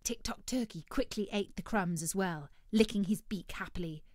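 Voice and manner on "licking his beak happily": ordinary delivery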